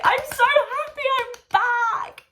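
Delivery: very high, loud squeal of delight; high-pitched happy squeal; squealing joy. Retold or imitated. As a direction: shriek it like a thrilled fan. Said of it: "I was there like".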